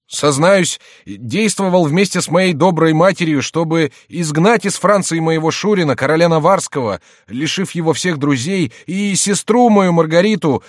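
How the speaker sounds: high, loud, declaimed confession; jester posing as penitent. A narrator's reading. Jester confesses for the king, high loud mock penitence. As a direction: pile up the sins in mock remorse.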